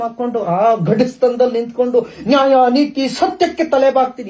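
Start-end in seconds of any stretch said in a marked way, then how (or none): 2.3-4.3 high, loud sneering parody of an oath; mock their pledge with scorn; mock-solemn sneer at the police oath; mocking quote.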